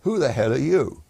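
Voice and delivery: high, loud rude challenge; station commander rudely demanding who he is. Retold or imitated. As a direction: bark it at an intruder. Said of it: "he very rudely said".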